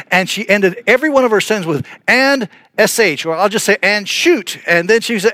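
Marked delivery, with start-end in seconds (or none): 3.8-4.6 high, emphatic mock swearing; tag-on curse. Pastor mimicking a convert's swearing at the pulpit, high and emphatic. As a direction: mimic her punchy habitual curse. Imitating her swearing with a substitute word.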